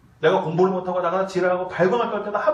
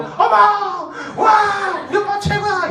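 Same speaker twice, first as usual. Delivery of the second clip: high gushing cheer for her man; excited praise; 11 semitones higher, 4 dB louder, slower than usual. Shown as a demonstration. 설교자가 아내의 리액션을 시범: 들뜬 감탄. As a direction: gush with delight. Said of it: "이거 연습하라고".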